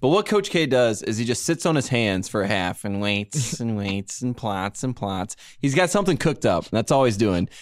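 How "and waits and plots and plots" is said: scheming villain. Retold or imitated. Mock sinister scheming coach.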